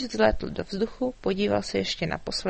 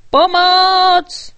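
high, loud panicked scream; knight screaming; 11 semitones higher, 14 dB louder than usual. A narrator's reading. Knight carried off by the dragon, screaming for help. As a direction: shriek for help mid-air. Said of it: "ječel rytíř".

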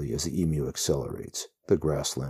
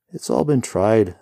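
higher, louder, offhand rider voice; breezy rider voice; 5 semitones higher, 8 dB louder than usual. A narrator's reading. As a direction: shrug off horror lightly. Rider's voice in story.

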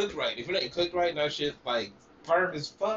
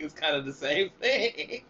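laughing; 6 semitones higher than usual. Real, not acted. Laughing at own story.

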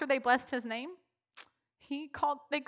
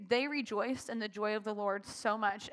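ordinary talk

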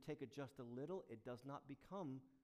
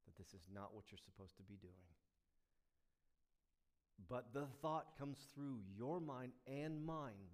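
normal talking voice

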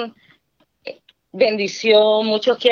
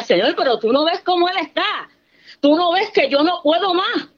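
much higher, anguished pleading; desperate plea; 9 semitones higher than usual. Retold or imitated. A wife begging God to see her husband's state, high anguished plea. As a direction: cry out that you can't take more.

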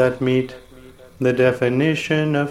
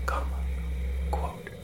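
softly spoken punctuation; dictating; 11 dB quieter than usual. Acted. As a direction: drop the voice for the punctuation. Reading punctuation aloud.

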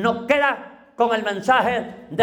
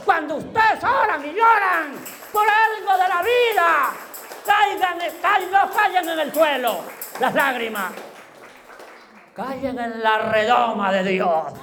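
fervent preaching. Real, not acted. Preacher exhorting the congregation.

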